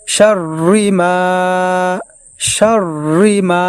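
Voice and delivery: শাররি মা slow with held nasal; nasal ghunna. Shown as a demonstration. Teacher demonstrates ghunna on শাররি মা, slow and held. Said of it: "এইভাবে গুণনা করব".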